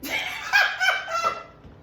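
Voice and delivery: high shrieking burst of laughter. Real, not acted. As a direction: scream with laughter. Friend reacts to burning lips.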